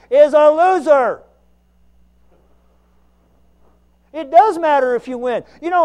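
loud, driving sermon build; fiery preaching. Acted. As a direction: preach it out, full voice. Preaching at full force.

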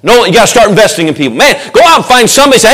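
normal talking voice

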